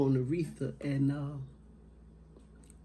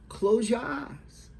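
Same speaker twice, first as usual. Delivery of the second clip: high, slow, louder instruction; retold motherly command; 8 semitones higher, 5 dB louder, slower than usual. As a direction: slowly raise the voice as a mother directing her son.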